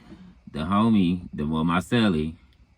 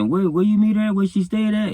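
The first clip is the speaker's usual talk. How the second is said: pitched way up and louder, urgent; high alarmed celly; 7 dB louder than usual. Retold or imitated. Cellmate demanding where the girl lives, high urgent questioning.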